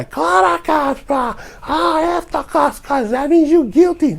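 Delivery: high taunting squeak; mock skinny guy. Mocking the skinny guy daring him, high squeaky taunt. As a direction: pitch it way up and sneer. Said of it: "the skinny guy".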